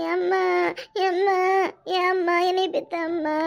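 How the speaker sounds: drawn-out mock sobbing for mother; crying lament. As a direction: wail "amma" in a put-on lament. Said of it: "எதுக்கு அழுவுற". (இழுத்து அழும் போலி ஒப்பாரி)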